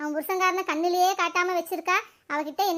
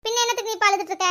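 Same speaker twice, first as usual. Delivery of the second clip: fast scolding; 2 semitones higher, faster than usual. Acted. Friend scolds her for crying, quick and pushy. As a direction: rattle off the scolding question fast.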